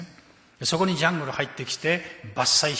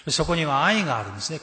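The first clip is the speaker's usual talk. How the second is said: ordinary delivery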